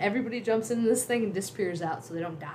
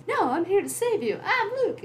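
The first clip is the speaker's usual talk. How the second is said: eager hero; 13 semitones higher than usual. Retold or imitated. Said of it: "And he goes".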